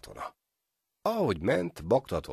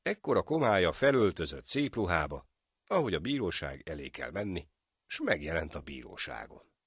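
ordinary delivery

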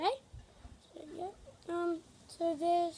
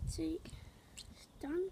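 whispering; 9 dB quieter, slower than usual. Real, not acted. Whispering aside to camera.

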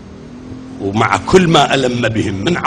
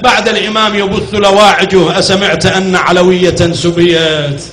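loud, raised lament preaching; full-force sermon recitation; 3 semitones higher, 3 dB louder than usual. Acted. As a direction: drive the mourning story to the crowd.

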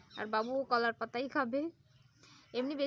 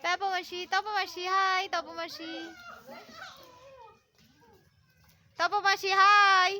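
calling out; 6 semitones higher, 8 dB louder, much slower than usual. Real, not acted. Calling out to aunt.

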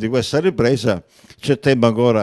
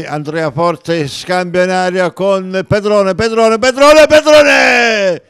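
excited commentary; 7 semitones higher, 8 dB louder, slower than usual. Real, not acted. Rising attack call.

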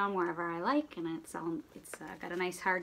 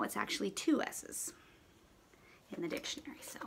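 whisper; 7 dB quieter than usual. Real, not acted. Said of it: "if you didn't know".

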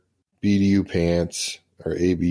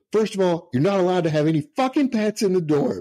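high, fast scolding rant; first sergeant chewing out the airman, high mock yell; mock furious sergeant; 11 semitones higher, faster than usual. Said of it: "He goes".

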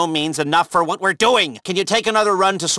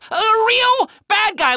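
much higher, slow shouted outburst; incredulous shout; slower than usual. Acted. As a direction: stretch it out in shocked disbelief. Disbelief at Avon's betrayal.

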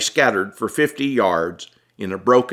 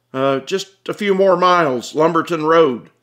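raised, hesitant reply; Tom, hesitant; 8 semitones higher, 4 dB louder than usual. Acted. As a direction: answer quickly, eager to go. Tom answers cop.

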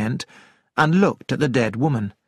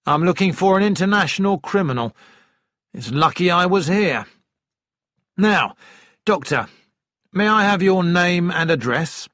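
lightly voiced inspector, higher pitch; businesslike police inspector; 5 semitones higher than usual. A narrator's reading. Inspector questions the doctor in a nearby room, mildly official. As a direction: take charge briskly, a touch official.

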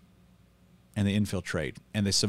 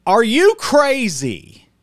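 slow, loud and higher, Are you crazy; incredulous outburst; 11 semitones higher, 9 dB louder, much slower than usual. Real, not acted. Pastor reacting to Jackie Hill Perry, slow outraged disbelief. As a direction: drag it out in disbelief.

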